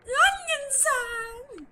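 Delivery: joyful call. Real, not acted. Greeting the character happily.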